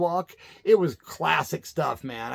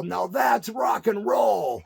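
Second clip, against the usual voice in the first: slow, higher mock yell; crowd yell; 6 semitones higher, much slower than usual. A fan yelling after the show, slow and higher. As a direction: call it out drawn out from the crowd. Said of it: "some guy in the crowd yell".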